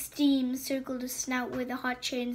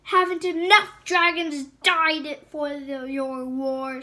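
snarled; 4 semitones higher, 13 dB louder than usual. Acted. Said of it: "snarled Hiviter".

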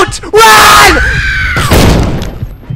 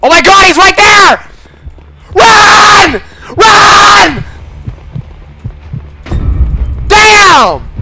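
high, loud frantic yells; panicking gamer; 5 dB louder, slower than usual. Real, not acted. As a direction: shout in a rush of panic. Killer spotted, panics.

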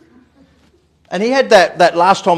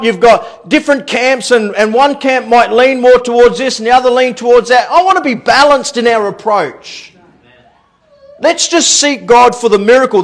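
raised, emphatic sermon voice; preaching; 10 semitones higher, 8 dB louder than usual. Real, not acted. Preacher urging church to seek God for the miracle, emphatic preaching. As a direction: preach it out to the congregation.

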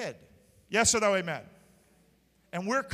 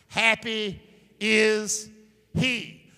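slow emphatic reading; 7 dB louder, much slower than usual. Real, not acted. Congregation reading scripture.

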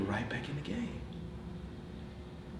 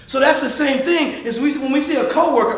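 usual speaking voice

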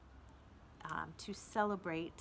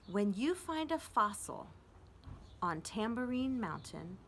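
poem reading; 2 semitones higher than usual. Acted. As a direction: read each line like a gentle invitation. Teacher reads poem aloud.

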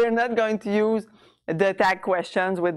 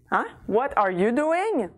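ordinary talk

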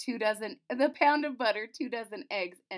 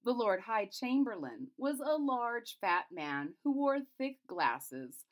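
usual speaking voice

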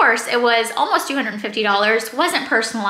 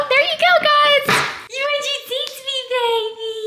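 much higher, cooing sing-song; 13 semitones higher than usual. Real, not acted. Mom talking to her baby.

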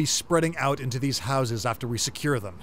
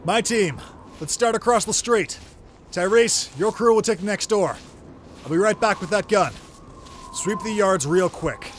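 calling out; 6 semitones higher than usual. Acted. Leader calling out orders outdoors.

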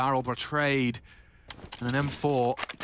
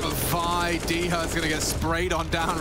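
ordinary talk, nothing marked

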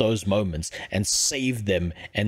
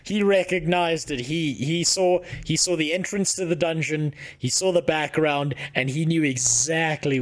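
excited; 6 semitones higher than usual. Real, not acted. Excited the viewer recognized dungeon.

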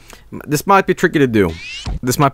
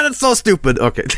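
ordinary talk, nothing marked

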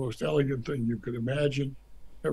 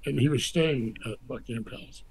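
usual speaking voice

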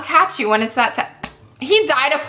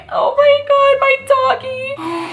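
high, drawn-out fake crying; mock wail; 14 semitones higher, slower than usual. Gamer mourning her virtual dog, hammed-up wail. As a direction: ham up a whiny sob over the pet.

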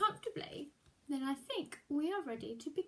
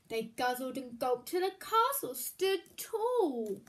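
usual speaking voice

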